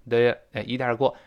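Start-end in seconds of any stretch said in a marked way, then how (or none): none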